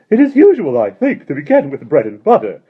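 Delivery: put-on high, loud jolly old man; Mister Wig's voice. A narrator's reading. Mister Wig welcoming the children, high and jolly. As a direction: beam at the children like a merry host.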